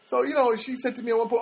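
ordinary delivery

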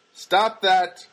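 loud, sharp reprimand; self-scolding. Pretend. Telling himself to forget the girl, loud scolding. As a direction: order yourself firmly to quit it.

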